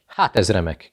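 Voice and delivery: ordinary delivery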